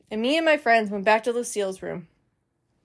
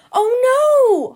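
much higher, much louder crying shout; hollered; 13 semitones higher, 7 dB louder than usual. Acted. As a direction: wail it out in alarm. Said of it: "hollered Lucille".

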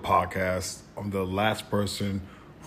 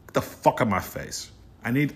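normal talking voice

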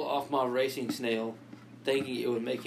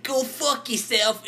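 high, loud, slow British telling-off; hammed-up British insult; mock British accent; 10 semitones higher, 4 dB louder, slower than usual. Telling a friend off, loud mock British accent. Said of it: "in my British accent".

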